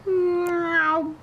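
playful meow. Pretend. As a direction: mew like a cat at the camera. Zooming camera, meows.

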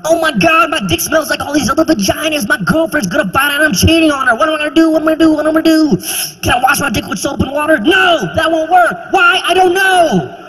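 high, fast, frantic panic; a high, fast mock panic; panicked rocker. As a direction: babble like a rocker about to be caught. Panicked Motley Crue guy running around.

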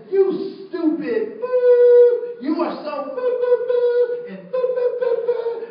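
loud, high bleeped-out cursing; puffed-up yelling, swear words as booming boos; mock angry tirade. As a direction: rant with drawn-out "boo" for each swear word. Man cursing, bleeped, in story.

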